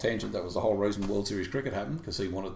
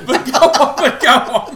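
high and loud through laughter; laughing, waving them on; 18 dB louder, slower than usual. Real, not acted. Laughing, urges co-host to continue.

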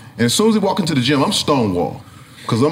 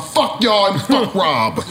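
ordinary delivery

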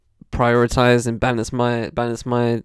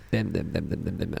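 soft, low mumbled pointing out of culprits, them them them; hushed snitching under the breath; quiet rapid naming; 6 semitones lower, 11 dB quieter than usual. Retold or imitated. Quietly listing the kids to the head of year, softly. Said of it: "I was like".

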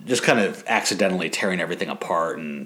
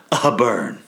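drawn-out, higher-pitched gloating jab; mock taunt; 4 semitones higher, 4 dB louder than usual. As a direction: land it like a playful insult. Mock taunt ending a retold tirade.

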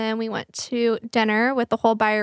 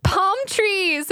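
high, hammed-up helpless cry; mock-panicked wail; retold panic; 9 semitones higher than usual. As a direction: blurt it high as if lost and desperate. Retold panicked answer while lost.

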